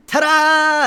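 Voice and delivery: loud high showman's cheer; triumphant ta-da. Acted. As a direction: land it like a circus act. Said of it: "scamper happily".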